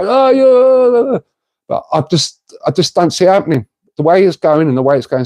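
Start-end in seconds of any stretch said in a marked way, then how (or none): 0.0-1.2 high, sarcastic "Oh, yeah" in a put-on voice; mock the doubters with a high scoff. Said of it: "people might go".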